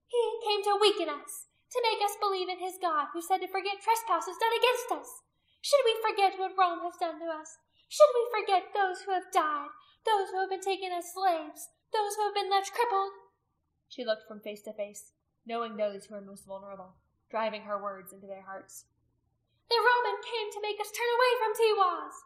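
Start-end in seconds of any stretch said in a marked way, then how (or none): 0.1-13.1 high, loud rabble-rousing speech; scornful priestess. A narrator's reading. Priestess stirring the crowd against the Roman, high and loud. 19.7-22.1 high, loud impassioned proclamation; crying out. A narrator's reading. Priestess warning her people about the Roman, crying out. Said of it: "she cried out".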